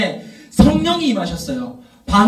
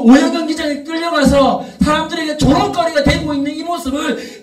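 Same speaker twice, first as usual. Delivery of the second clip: Jesus rebuking; 5 semitones higher than usual. Acted. Jesus confronting the forgetful church, in a rising pleading rebuke. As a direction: ask the church why it forgot, rising to a cry. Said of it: "묻는 거예요".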